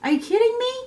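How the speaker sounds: high, loud "are you kidding me"; mock disbelief. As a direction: play a shocked, scoffing reaction. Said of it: "and you're like".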